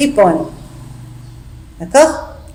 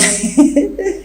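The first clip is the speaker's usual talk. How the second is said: a long helpless giggle; maid's giggle; 7 semitones higher, 3 dB quieter than usual. Acted. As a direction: laugh in his face. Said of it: "Nicole n'arrête pas de rire".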